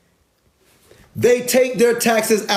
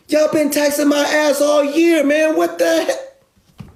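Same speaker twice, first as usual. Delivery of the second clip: much higher, fed-up rant; exasperated outburst; 11 semitones higher, slower than usual. Real, not acted. Fed up with taxes all year, higher exasperated rant. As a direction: vent it at the taxman.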